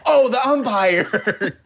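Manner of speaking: high, slow, laughing exclamation; excited exclamation. Retold or imitated. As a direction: stretch it out like a sudden discovery. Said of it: "be like".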